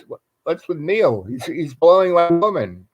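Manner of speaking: high-pitched mock bewilderment; puzzled reaction. Woken man reacts, puzzled, to crying.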